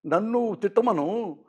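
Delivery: quiet, hurt voice of a man close to tears; teary complaint. Retold or imitated. Chiranjeevi on the phone to Murali, quietly teary. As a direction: retell his tearful plea softly. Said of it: "కన్నీళ్ళు పెట్టుకుని మాట్లాడుతుంటే".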